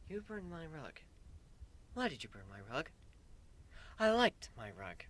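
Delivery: soft, hushed complaint; lightly voiced princess mourning her rug; a quiet, wounded scolding over a rug; hint of a sulky princess. Celestia scolds the narrator.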